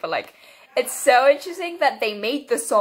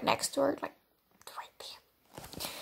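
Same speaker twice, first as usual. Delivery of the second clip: whisper; 2 semitones higher, 12 dB quieter than usual. Real, not acted.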